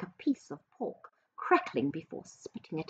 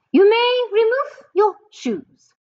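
far higher and louder, slow bark; barked witch order; 9 dB louder than usual. Acted. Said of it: "barked the Grand High Witch".

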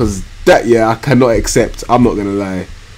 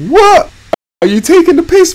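high, louder shock; shocked, higher and louder; outraged shout; 5 dB louder than usual. Real, not acted. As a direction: yelp of disbelief at the screen. Conceding a goal in game.

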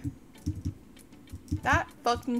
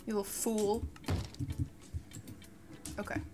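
ordinary talk, nothing marked

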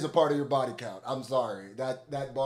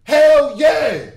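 loud, much higher hype shouts; hyped shout; 11 semitones higher, 11 dB louder, much slower than usual. Real, not acted. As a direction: yell it out, blown away by the rapping. Hyped by the verse.

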